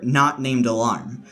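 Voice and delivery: ordinary talk, nothing marked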